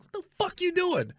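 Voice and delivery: high-pitched mock indignation; retold cussing out. Man opening door to cuss her out, high and indignant. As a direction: squawk it in exasperation. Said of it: "to cuss her out".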